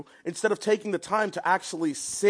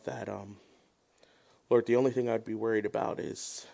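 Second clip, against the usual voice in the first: prayer; 7 semitones lower than usual. Acted. Pastor leads opening prayer.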